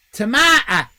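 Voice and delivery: "tomato" said loud as "to-MAH-to" with the A of father; British tomahto. Shown as a demonstration. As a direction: insist on "tomato" the Irish way. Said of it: "It's a tomato".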